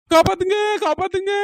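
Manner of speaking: high, loud pleading; panicked cry for help. Acted. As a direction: yell desperately for rescue. Panicking woman screams for rescue.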